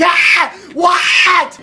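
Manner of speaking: high, loud outburst; cheer, then cry out in disbelief; disbelieving yell. Real, not acted. Player robbed of a win, yelling in disbelief.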